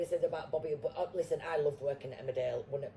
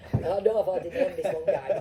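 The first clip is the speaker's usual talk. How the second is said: laughing through it, a bit louder; 4 semitones higher, 10 dB louder than usual. Real, not acted. Guest laughing while telling the host about losing a job. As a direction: laugh as you confess. Laughing at her own story.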